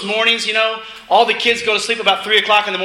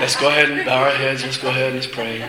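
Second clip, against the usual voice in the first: low, resigned call to pray; mock going-through-the-motions minister, pitched well down; dutiful mock prayer call; 7 semitones lower than usual. Token minister asked to pray over the family food, dutiful and low.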